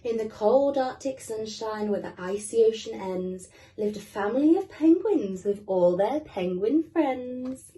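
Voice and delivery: lively storybook reading for little ones; reading to children. Acted. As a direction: read it like bedtime for small kids. Reading picture book to kids.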